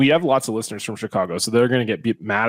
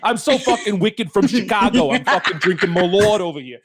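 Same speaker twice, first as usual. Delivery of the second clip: half-laughing hammed-up Boston accent, higher pitched; mock Boston accent; 6 semitones higher than usual. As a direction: play a Chicago guy bragging in a jokey Boston accent. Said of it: "it's like a Boston accent".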